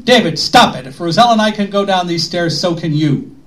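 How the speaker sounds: loud, firm retold snap; sharp, forceful rebuke, retold; snapped back. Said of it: "I just snapped back at him".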